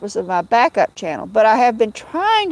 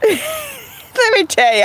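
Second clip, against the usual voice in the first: laughing; 11 semitones higher, slower than usual. Real, not acted. Still laughing, starting a story.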